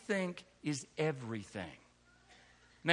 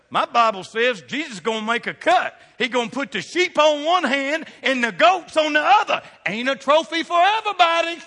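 higher, louder, building to a shout; full-force preaching; 7 semitones higher, 7 dB louder than usual. Acted. Preacher rising to the point about the cut. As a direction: preach it hard at the congregation.

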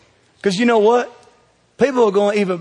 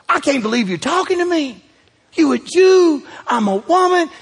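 higher, amazed Samaritan woman; incredulous woman; 9 semitones higher than usual. Acted. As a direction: voice her disbelief at being spoken to. Preacher voices the Samaritan woman.

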